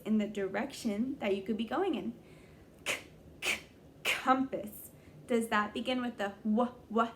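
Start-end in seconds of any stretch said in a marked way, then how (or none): 2.8-3.6 "k" as a bare click, twice; letter sound demo. Shown as a demonstration. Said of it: "compass begins with a". 6.4-7.1 "w" said as a short "wuh", twice; letter sound demo. Shown as a demonstration. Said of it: "w sound".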